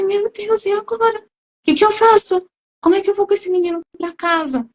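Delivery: voz alta e aflita, quase em pânico. Retold or imitated. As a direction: desespero de mãe sem saída. Mãe aflita com convênio negado. (mãe aflita, voz aguda de desespero)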